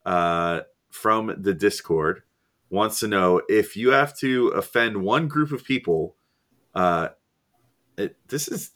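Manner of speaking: ordinary delivery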